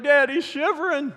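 high-pitched mock alarm; imitated worried son. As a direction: whine it up to your dad, hammed-up concern. Worried son voiced in story.

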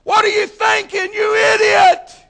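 full-throated, high shout from the stands; yelling parent. Acted. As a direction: yell it furiously, stretching the last word. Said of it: "you start yelling at somebody else's kid".

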